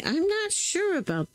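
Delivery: rising doubtful wonder; hint of skeptical sing-song. Real, not acted. Doubting a stamp to viewers.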